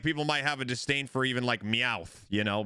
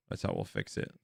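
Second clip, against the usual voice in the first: very low mutter; deep growl; 6 dB quieter than usual. Pretend. Muttering fix plan to self.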